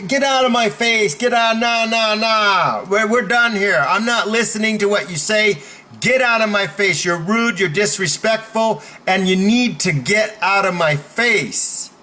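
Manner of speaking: high, insistent tirade; standing up forcefully. Shown as a demonstration. Coach demos standing up to a bully, insistent and forceful. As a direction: talk right over them, pitch held up. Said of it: "that's how you stand up for yourself".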